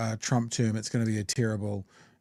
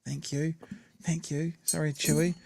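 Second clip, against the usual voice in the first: tender aside; 4 semitones higher, 6 dB quieter than usual. Real, not acted. Thanking partner who brought dinner.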